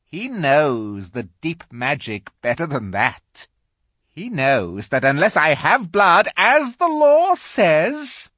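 high, loud witch voice. Acted. Witch argues her right.

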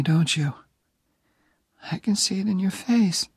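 put-on high, weak whisper of a dying young woman; dying girl's whisper. Dying Jenny asking Oliver to stay near, whispered. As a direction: breathe it out, barely there.

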